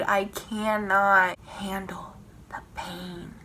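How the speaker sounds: slow whisper; anguished slow whisper. Real, not acted. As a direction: whisper the pain.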